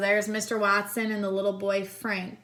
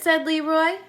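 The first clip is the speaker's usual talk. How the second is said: ordinary delivery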